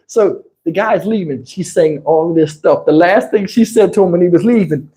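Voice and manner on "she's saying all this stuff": ordinary talk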